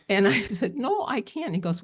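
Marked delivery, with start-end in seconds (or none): 0.8-1.5 much higher, tearful refusal; refusing her partner's push, high and tearful; retold crying. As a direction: refuse through tears, voice pitched way up. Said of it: "And I'm crying".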